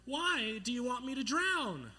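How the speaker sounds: high, loud, drawn-out plea; indignant cry. Retold or imitated. Boy asking his crush why, indignant cry. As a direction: wail it in mock hurt, slowly. Wounded boy calls up to girl.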